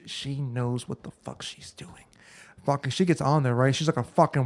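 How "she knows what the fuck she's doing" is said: hushed whisper of awe. Real, not acted. Telling his friend about the dancer, whispered. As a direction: whisper it like a secret.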